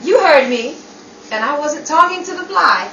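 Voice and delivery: loud, cutting reply; angry retort. Acted. Wife lashes out at Anne, loud angry retort. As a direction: throw it back at her, loud and sharp.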